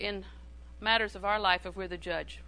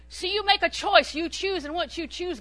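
emphatic preaching; 8 semitones higher, 6 dB louder than usual. Real, not acted. Preacher exhorting congregation.

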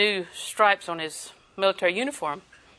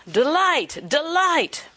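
delight drawn out, rising higher each time; savoring delight; 6 semitones higher, much slower than usual. Shown as a demonstration. Preacher relishing the word delight for her congregation. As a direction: linger lovingly on delight. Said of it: "I love that word delight".

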